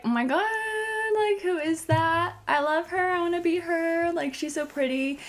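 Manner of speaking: high, gushing, sing-song fangirl; breathless fan gushing in a high voice. Retold or imitated. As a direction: squeal like a starstruck fan. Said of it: "I was like".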